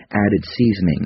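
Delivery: ordinary delivery, nothing marked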